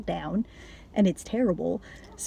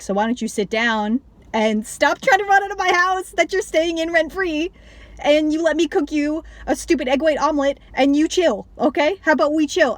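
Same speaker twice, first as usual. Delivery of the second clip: higher, louder, sarcastic scolding; exasperated Lou; 7 semitones higher, 4 dB louder than usual. Retold or imitated. Lou scolding Jackie to sit down, exasperated and sarcastic. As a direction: talk her down like a frazzled babysitter. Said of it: "Lou's like".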